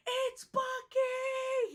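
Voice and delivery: put-on high, slow, stretched kid's cry of delight; excited kid. A kid spotting Bucky, shouting high and drawn out. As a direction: squeal it like a thrilled child. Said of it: "This one kid was like".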